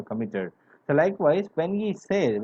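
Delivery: ordinary talk, nothing marked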